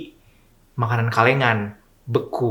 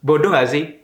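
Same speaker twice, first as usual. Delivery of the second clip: normal talking voice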